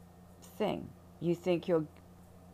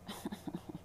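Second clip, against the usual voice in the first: soft whispered laugh; 11 dB quieter than usual. Real, not acted. Laugh after whispered aside.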